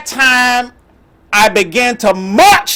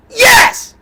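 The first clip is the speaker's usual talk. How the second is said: niece's yes. Retold or imitated. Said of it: "And she says".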